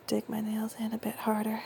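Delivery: soft menacing whisper; hushed, teasing whisper. Acted. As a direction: whisper the threat close. Intimate roleplay, escalating.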